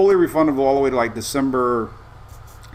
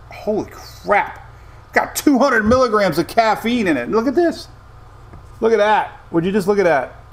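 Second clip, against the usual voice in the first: amazed; 6 semitones higher than usual. Real, not acted. Shocked by caffeine on can.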